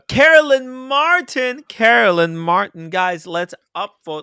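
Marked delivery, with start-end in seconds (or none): 0.1-1.6 much higher, louder and slow, announcing Carolyn Martin; host hyping a viewer's name; calling out a name. Real, not acted. Reading out a super chat name. 1.7-2.9 louder, slow second call of Carolyn Martin, less high; host repeating the name for chat; repeated callout. Real, not acted.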